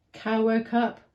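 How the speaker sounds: usual speaking voice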